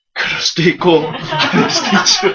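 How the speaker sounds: slow sage voice with a laugh through it; slow, laughing, unbothered elder; amused old sage. Acted. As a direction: chuckle out the refrain slowly. Sage repeats refrain, amused.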